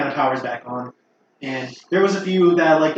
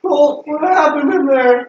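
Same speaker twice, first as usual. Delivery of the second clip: high, slow, panicked cry; 11 semitones higher, 7 dB louder, slower than usual. Acted. GM voices panicked NPC.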